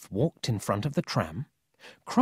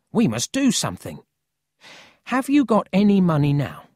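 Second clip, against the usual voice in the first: building to a higher, louder question; lightly voiced resolute boy; 7 semitones higher, 6 dB louder than usual. A narrator's reading. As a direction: take charge of the problem. Paul takes charge.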